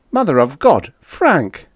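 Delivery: high, loud amazement; astonished exclamation. Acted. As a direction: cry out to a crewmate in disbelief. Spaceman astonished at the sighting.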